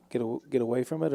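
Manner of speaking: normal talking voice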